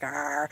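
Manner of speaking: demonstrated low, soft "arr"; irritated-throat groan, low and quiet; low acid-throat growl. Shown as a demonstration. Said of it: "It's just like".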